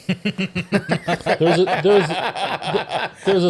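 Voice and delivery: speaking through laughter as the story picks up; laughing through it. Real, not acted. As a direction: keep laughing while you tell it. Laughing while telling the story.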